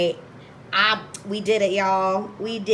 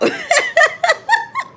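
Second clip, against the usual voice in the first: very high, loud laughter; big laugh; 7 dB louder than usual. Real, not acted. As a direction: burst out laughing at yourself. Laughing at herself at sign-off.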